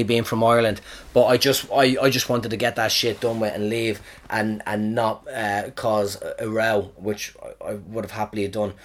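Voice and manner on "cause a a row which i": ordinary talk, nothing marked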